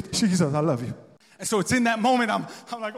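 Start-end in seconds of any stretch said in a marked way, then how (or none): 0.1-0.9 blubbering, overcome declaration; hammed-up weepy devotion; mock tearful worship. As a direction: play someone sobbing at the Spirit's touch. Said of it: "equivalent to the Holy Spirit touching you".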